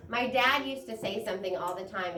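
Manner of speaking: usual speaking voice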